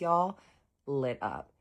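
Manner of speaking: normal talking voice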